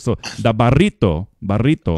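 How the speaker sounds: "burrito" with a trilled R; Spanish "burrito", R rolled. Shown as a demonstration. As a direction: roll the R in "burrito" hard. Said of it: "he taught me to roll my R's".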